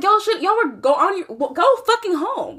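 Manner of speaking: high-pitched exasperated urging; mock-scold them from afar. Telling the fighting couple to leave, exasperated scolding.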